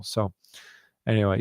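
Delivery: usual speaking voice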